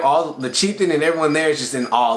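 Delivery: ordinary talk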